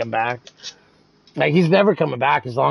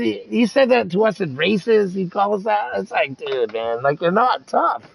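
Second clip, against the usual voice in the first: ordinary delivery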